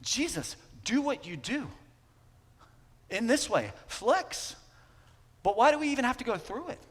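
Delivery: high, pushy plea at Jesus; demanding disciples. Retold or imitated. Preacher voicing disciples demanding Jesus act, high and pushy. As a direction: needle him to flex his power. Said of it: "the assumption of the disciples".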